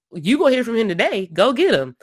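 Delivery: acted-out emphatic command; imitated firm order. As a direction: overrule him like an impatient king. Acting Jehoshaphat overruling reluctant Ahab.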